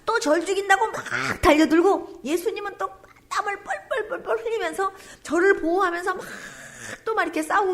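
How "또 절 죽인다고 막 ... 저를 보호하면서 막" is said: usual speaking voice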